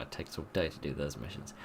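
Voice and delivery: ordinary talk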